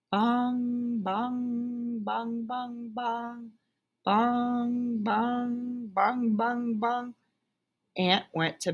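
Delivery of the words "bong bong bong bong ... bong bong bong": higher, steady bong like a clock; chiming clock, slow and even; clock chimes. Acted. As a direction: ring out each bong evenly. Clock striking ten.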